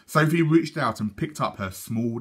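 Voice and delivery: ordinary delivery, nothing marked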